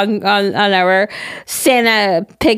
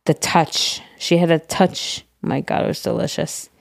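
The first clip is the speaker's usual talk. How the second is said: hushed longing; 5 semitones lower, 6 dB quieter than usual. Real, not acted. Longing for a late cook's gravy.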